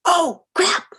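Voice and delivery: high, loud alarmed "oh, crap"; a startled, pained outcry; dentist's shocked curse. Retold or imitated. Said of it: "He went".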